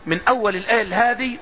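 usual speaking voice

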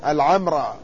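العمرة with a first, silent m, long ā; fatha on first letter. Shown as a demonstration. Lecturer showing a third variant, العمرة as al-ʿamrā. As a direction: say العمرة as al-ʿamrā, a after the ʿayn. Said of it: "فتح أوله مع السكون".

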